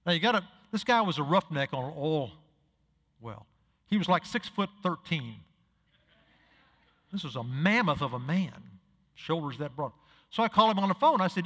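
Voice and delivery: normal talking voice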